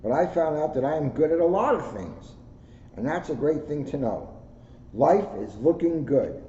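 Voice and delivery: put-on bright, lively storybook read in a child's voice; reading to children. A narrator's reading. Officer reading a picture book to schoolkids, lively storybook voice. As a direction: read it like a proud kid telling his story. Reads kids' book aloud.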